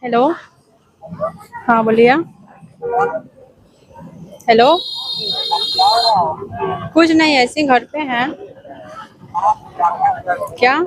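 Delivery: put-on man putting on a girl's voice on a call. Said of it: "लड़की की आवाज में बात करेंगे".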